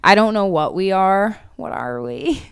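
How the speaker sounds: usual speaking voice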